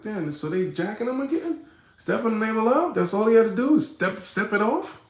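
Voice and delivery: high, loud incredulous put-on voice; mocking high disbelief.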